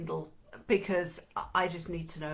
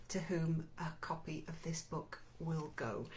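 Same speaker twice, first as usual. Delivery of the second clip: whisper; 2 semitones lower, 8 dB quieter than usual. Real, not acted. Giveaway instructions.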